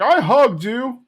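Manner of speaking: imitated indignant wail, pitched high; wounded protest. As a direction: call after someone leaving, comically betrayed. Hank calls after departing customer.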